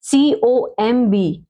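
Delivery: letters spelled one by one, very slow; धीरे-धीरे अक्षर बोलना; slow spelling out. Shown as a demonstration. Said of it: "कॉम".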